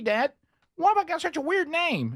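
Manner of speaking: put-on high, gee-whiz little kid voice; squeaky innocent kid asking dad; wholesome 1950s kid. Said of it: "Your kid comes to you and says".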